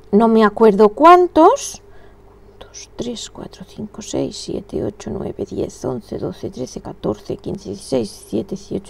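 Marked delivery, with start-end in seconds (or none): 2.6-8.9 counting stitches in a whisper. Real, not acted. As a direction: breathe the numbers out. Counting stitches under her breath.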